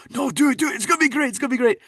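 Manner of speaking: put-on very high, urging Scottish accent; Gerard Butler. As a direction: egg him on in an excited Scottish voice. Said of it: "he's a Scottish actor".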